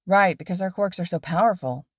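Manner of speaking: ordinary delivery, nothing marked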